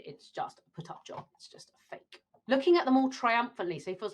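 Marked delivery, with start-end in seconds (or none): 0.0-2.2 whispered "It's just a put-up job" and "It's just a fake"; hushed, breathy dismissal. Teacher reading the play, whispering the hoax idea. Quiet aside in character.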